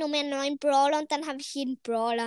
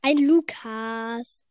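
drawled; 6 dB louder, slower than usual. Real, not acted. Repeated drawled answer.

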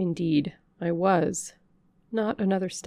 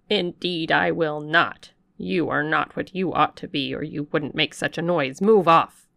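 louder, firm servant ending on a barked order; stern refusal; 7 dB louder than usual. Acted. Servant driving the vagrant away, stern and loud. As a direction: order her off the step.

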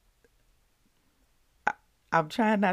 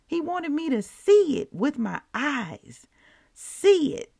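higher and louder with conviction; fervent preaching; 8 semitones higher, 5 dB louder than usual. Real, not acted. Host preaching to podcast listeners, higher and louder. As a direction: drive the point home.

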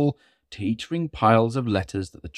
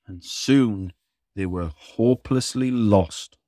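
usual speaking voice

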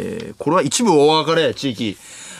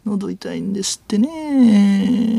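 slow, higher-pitched sleuth caricature; 粗品任三郎; 6 semitones higher, slower than usual. Acted. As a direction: stretch the ending as if probing a suspect. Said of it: "粗品任三郎さん。". (刑事のものまねで、ゆっくり語尾を伸ばして)

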